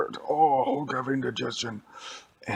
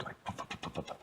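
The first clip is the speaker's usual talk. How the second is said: helicopter puffs; 15 dB quieter, faster than usual. Acted. Helicopter taking off.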